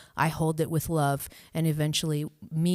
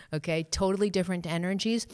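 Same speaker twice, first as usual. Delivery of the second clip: ordinary delivery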